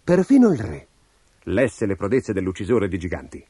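plain reading voice

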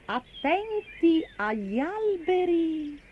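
high, slow, drawn-out reading; slow singsong sign-reading. Acted. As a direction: spell it out slowly, word by word.

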